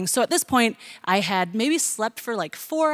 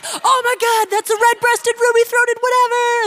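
excited crowd; 13 semitones higher, 4 dB louder than usual. Retold or imitated. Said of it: "people would be like".

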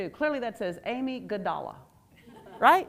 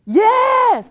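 much higher, loud cheering yes; excited shout; 14 semitones higher, 15 dB louder than usual. Real, not acted. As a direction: shout approval at the answer. Audience answer delights her.

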